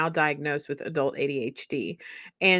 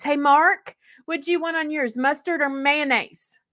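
higher mother calling across the kitchen; 10 semitones higher, 4 dB louder than usual. Retold or imitated. Mother in kitchen calling to her kids, higher and louder. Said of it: "she'd be calling out our names".

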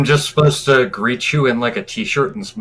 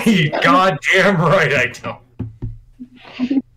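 laughing; 5 semitones higher, much slower than usual. Real, not acted. Denies being a Hoosier, laughing.

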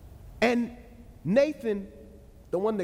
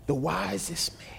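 hushed awe; 5 semitones lower than usual. Acted. Awed pause after naming next king.

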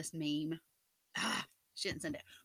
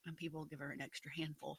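whispering; 5 semitones lower, 12 dB quieter, faster than usual. Real, not acted. Playful aside about trick-or-treating.

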